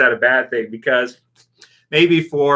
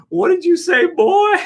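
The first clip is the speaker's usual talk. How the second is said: much higher, hammed-up quote ending in a laugh; mock Alpha line; 4 dB louder than usual. Repeating a viewer's joke line to chat, high mock voice. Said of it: "And then he says".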